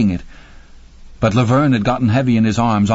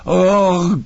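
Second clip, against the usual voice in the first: high, sharp horrified cry; cry of revulsion; 9 semitones higher than usual. A narrator's reading. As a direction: cry out in disgust. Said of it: "she cried".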